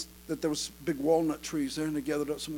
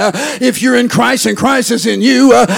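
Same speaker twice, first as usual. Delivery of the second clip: loud, driving preacher cadence; full-force preaching; 7 semitones higher, 20 dB louder than usual. Real, not acted. Preacher to congregation on resurrection and doubt, full-force preaching.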